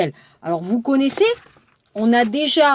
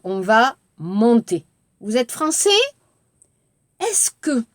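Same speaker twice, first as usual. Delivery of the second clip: ordinary talk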